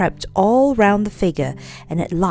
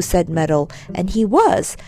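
plain reading voice

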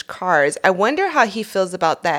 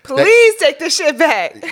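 pleading thief; 6 semitones higher, 8 dB louder, slower than usual. Retold or imitated. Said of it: "thief begging".

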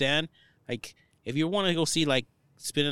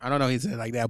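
ordinary talk, nothing marked

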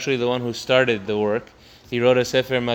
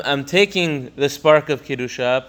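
ordinary talk, nothing marked

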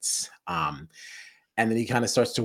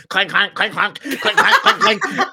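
loud, high, laughing clanks; piercing repeated clanks, climbing in pitch; clanking imitation; 13 semitones higher, 9 dB louder than usual. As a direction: imitate an irritating metal clatter. Imitates bottle clanking over whisper.

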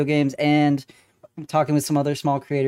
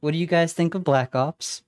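usual speaking voice

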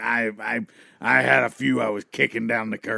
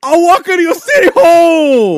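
much higher, loud booming entrance; wrestler entrance shout; 13 dB louder than usual. Acted. Wrestler enters the ring to the crowd, loud high shout. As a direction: yell it as you hit the ring.

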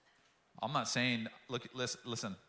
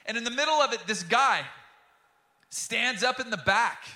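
loud, high, driving sermon voice; a pastor at full force; full-force preaching; 11 semitones higher, 9 dB louder than usual. Acted. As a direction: preach it out to the whole room.

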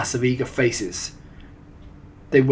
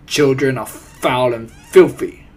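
loud, commanding witch pronouncement; forceful villainess declaration; thundering; 10 dB louder than usual. A narrator's reading. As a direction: thunder it out with disgust. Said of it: "thundered the Grand High Witch".

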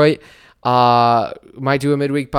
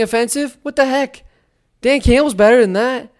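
high, hammed-up indignation; squeaky fake fury at the draft ranking; mock outrage; 10 semitones higher, 4 dB louder than usual. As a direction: play wounded pride, overdone and high.

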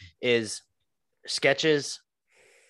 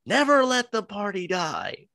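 high, slow, stretched-out declaration; sketch character impression; 10 semitones higher than usual. Cohost quoting the restaurant sketch, high drawn-out declaration. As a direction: proclaim it high, dragging the words out.

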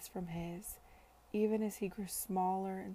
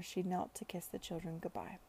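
ordinary delivery

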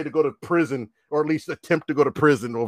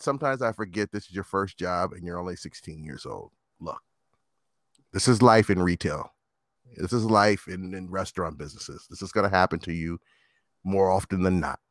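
low, softer, measured explaining; calm manager; 10 semitones lower, 5 dB quieter than usual. Retold or imitated. Manager explaining retail life to a young worker, calm. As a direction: imitate a patient boss, pitched well down. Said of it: "he was so calm about it".

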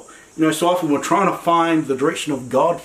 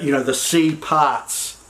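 ordinary delivery

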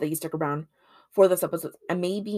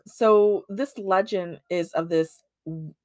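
normal talking voice

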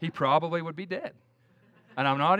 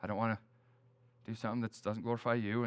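retold quiet prayer; 7 semitones lower, 9 dB quieter, faster than usual. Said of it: "I was praying".

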